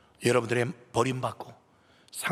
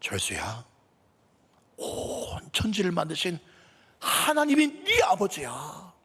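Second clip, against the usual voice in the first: building declaration; 3 semitones higher, slower than usual. Retold or imitated. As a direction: build from a hush to a proud shout. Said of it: "이 전도사님이 그래요". (전도사가 철수에게, 낮게 시작해 크게 외치듯)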